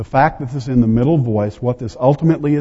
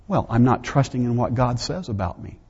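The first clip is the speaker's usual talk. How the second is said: ordinary delivery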